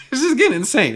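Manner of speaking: laughing in disbelief. Real, not acted. Telling viewers about the lawyer-fee objection, laughing.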